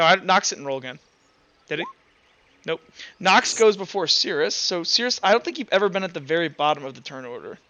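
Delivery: usual speaking voice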